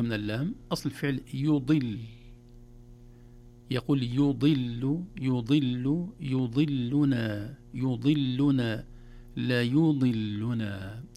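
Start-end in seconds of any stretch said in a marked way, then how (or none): 4.0-11.0 يضل then يضلنا built up slowly; step-by-step drill. Shown as a demonstration. Teacher drills a beginner through يضلنا in stages. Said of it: "يأتي بالفعل أولًا يضل وحده".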